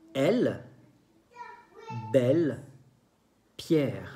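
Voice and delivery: "el, belle, pierre" each with an open eh; open E sound. Shown as a demonstration. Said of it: "se escucha un sonido que es abierto". ("el, belle, pierre" con e abierta)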